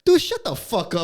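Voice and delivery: high, loud mock outburst; acted-out exasperated snapback; irritated retort. Retelling his retort to cop.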